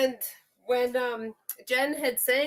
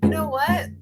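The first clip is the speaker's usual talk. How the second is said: ordinary talk, nothing marked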